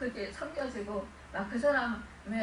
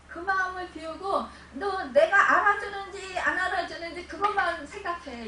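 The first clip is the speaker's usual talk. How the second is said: higher and louder, as the Lord's firm command; 5 semitones higher, 8 dB louder than usual. Retold or imitated. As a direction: ring out the command to empty the heart. (높고 크게 명령하는 주님의 목소리)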